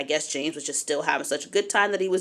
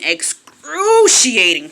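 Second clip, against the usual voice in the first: "excruciating" stretched out high and loud; hugely drawn-out stress on "excruciating"; emphatic; 5 semitones higher, 7 dB louder, much slower than usual. Real, not acted. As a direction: drag out and punch "excruciating". Stresses how bad the pain was.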